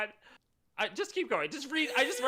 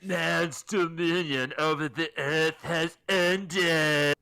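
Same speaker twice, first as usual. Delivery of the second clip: very slow, loud pronouncement; grandiose voice of God; 5 dB louder, much slower than usual. Acted. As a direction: proclaim it solemnly to the world. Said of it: "I'm just gonna read all these lines".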